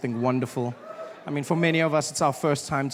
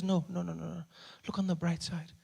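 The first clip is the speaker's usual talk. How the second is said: soft reassuring mom; 10 dB quieter than usual. Retold or imitated. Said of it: "And she says".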